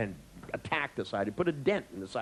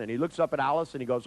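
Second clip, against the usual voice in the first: ordinary talk, nothing marked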